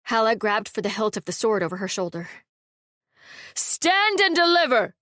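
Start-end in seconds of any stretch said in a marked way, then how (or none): 3.6-4.9 slow, high bandit's bellow; shout. A narrator's reading. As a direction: call out a hold-up from the woods. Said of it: "someone shouted from the trees".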